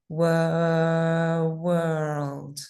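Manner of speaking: "Wor-" held long then "world" finished; stretched vowel. Shown as a demonstration. Teacher shows students how to practise "world" slowly. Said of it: "stay on it for a while".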